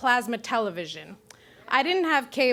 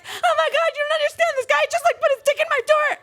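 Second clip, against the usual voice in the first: high, loud frantic pleading; panicked call; 4 dB louder, faster than usual. Retold or imitated. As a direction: blurt it to the dispatcher in panic. Panicked nine one one call.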